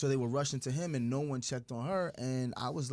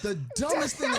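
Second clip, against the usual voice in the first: high, loud exclamation, nearly laughing; hammed-up disbelief, pushed high; mock exasperation; 12 semitones higher, 5 dB louder, faster than usual. Husband reacts to silly argument, mock-exasperated.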